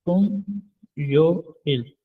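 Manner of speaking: तुम, then यो इल, with a pause between; syllables split apart. Shown as a demonstration. Korean teacher breaking तुम यो इल into parts for students. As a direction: pull तुम away from यो इल. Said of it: "तो ये पढ़ा जाएगा".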